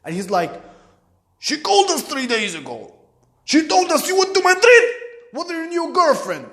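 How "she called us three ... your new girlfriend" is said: high, loud, mounting accusation; angry father. Retold or imitated. Father confronting son with what Sharzad told, rising fury. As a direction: accuse him, climbing to an outraged peak. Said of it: "I know the tone in his voice".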